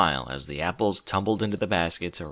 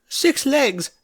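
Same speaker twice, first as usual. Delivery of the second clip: breathless; 5 dB louder than usual. A narrator's reading. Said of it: "Rarity breathed".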